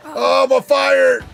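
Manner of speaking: loud, high, drawn-out shout; real thrill, yell it slowly; excited yell. Real, not acted. Playing the game, real excited yell.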